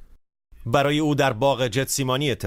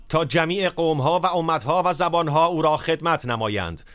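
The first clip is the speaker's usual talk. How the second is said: solemn scripture proclamation; 4 semitones higher, slower than usual. Acted. Proclaims Daniel's vision.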